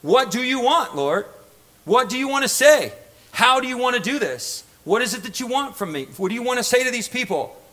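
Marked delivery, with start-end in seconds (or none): none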